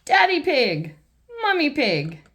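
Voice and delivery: ordinary delivery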